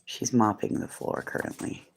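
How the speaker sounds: hushed amused whisper about her. Real, not acted. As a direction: whisper so she won't hear. Whispering about someone nearby.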